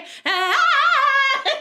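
shrieking laugh after the belt; high burst of laughter. Real, not acted. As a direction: laugh out loud, high and bright. Laughs after mock belting.

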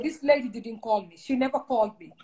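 normal talking voice

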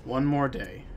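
usual speaking voice

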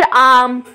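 आम with a long, full आ. Shown as a demonstration. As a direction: open wide on the आ of आम. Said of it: "आ की बड़ी आवाज़ आ रही है". (आम में लंबा आ)

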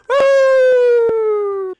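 loud high whoop; cheer. Acted. Arriving fresh off the boat, loud high whoop. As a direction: let out a joyful woo.

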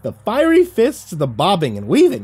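very high, giddy squirrel voice; excited squeaky squirrel. A narrator's reading. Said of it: "it squeaked".

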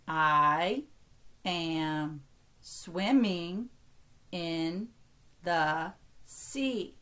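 drawn-out words with gaps between. Shown as a demonstration. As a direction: count out each word slowly, keeping words whole. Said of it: "only count each word as one".

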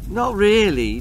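high, cheeky answer. A narrator's reading. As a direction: sass the fire chief. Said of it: "cheeked Augustus".

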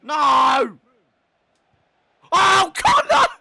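high, very loud outcry; anguished shouting. Real, not acted. Gamer watching Connor fail, anguished shouting. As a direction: yell as the chance slips away.

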